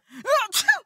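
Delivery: high, loud fake sneeze; fake sick noise. Disguised initiate feigning illness, loud fake sneeze. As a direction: burst out a put-on sneeze. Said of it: "a bit under the weather".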